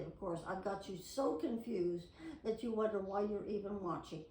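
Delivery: usual speaking voice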